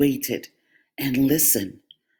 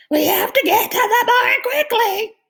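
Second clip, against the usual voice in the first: very high, louder duck voice with a laugh; 6 dB louder than usual. A narrator's reading. Duck hurries everyone to the barn. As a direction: squawk it with a giggle.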